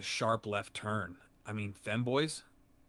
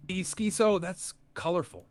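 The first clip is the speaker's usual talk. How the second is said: much higher, louder disbelief; surprised; 9 semitones higher, 6 dB louder than usual. Real, not acted. AI hearing a chatter's question, surprised. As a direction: caught off guard.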